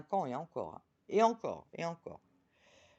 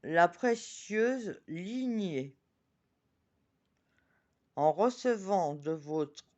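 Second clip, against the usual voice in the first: slow, solemn oracle reading; solemn card reading; slower than usual. Acted. Reading the oracle card aloud to viewers, slow and solemn. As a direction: read the message slowly and reverently.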